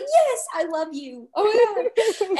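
high, drawn-out fangirl squeal; retold excited scream. Reader reliving her favorite couple's cameo, squealing. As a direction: gush in a mock scream, breaking into a laugh. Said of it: "I screamed. I was like".

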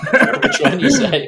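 words squeezed out through laughter; laughing, high and loud. Real, not acted. Laughs at skin wallet.

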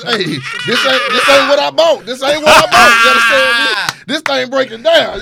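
loud, laughing protest; joking outraged shout; mock customer complaint. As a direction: holler it like a cheated buyer. Mock complaint about faulty purchase.

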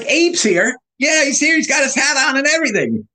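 very high, excited insistence; giddy, squeaky-high and rushing by the end; retold delusional excitement. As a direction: act out someone sure of a hallucination. Acts out his hallucinating self.

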